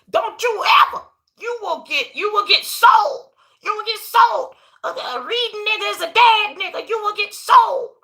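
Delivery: hammed-up frantic parent shout; mock scolding parents. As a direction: yell it like a scared parent warning. Parents scolding after slapping child.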